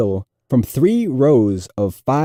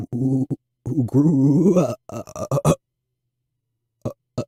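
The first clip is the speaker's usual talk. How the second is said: heavy stutter. A narrator's reading. Nervous fourth grader stuttering through his book report to class. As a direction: fight through each repeated sound. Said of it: "relieved that his presentation was over".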